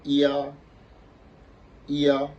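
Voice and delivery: ya held high and level; first tone. Shown as a demonstration. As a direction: sing out "ya" loud on one steady high pitch. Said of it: "ça va faire quoi".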